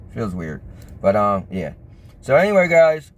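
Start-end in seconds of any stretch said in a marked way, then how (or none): 0.1-1.7 numb mouth, slurred and low; groggy from anesthesia. Real, not acted. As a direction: talk through a numbed face. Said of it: "my whole face is numb".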